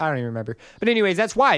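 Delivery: normal talking voice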